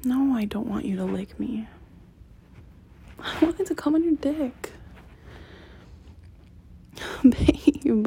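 ordinary talk, nothing marked

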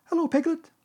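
loud, very high little squeak. A narrator's reading. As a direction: squeak it out like an eager little one. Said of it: "he squeaked".

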